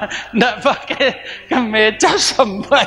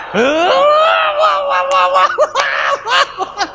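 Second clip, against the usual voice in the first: mock wailing; much slower than usual. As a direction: wail like a forced crier. Finally forcing himself to cry.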